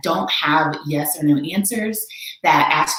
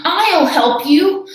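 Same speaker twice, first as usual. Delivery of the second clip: higher, louder and slow; Horton calling out; 10 semitones higher, 6 dB louder, much slower than usual. Acted. Horton answers the speck, slow loud high call. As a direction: call out to the tiny voice. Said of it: "said Horton".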